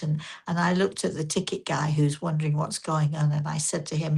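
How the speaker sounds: ordinary talk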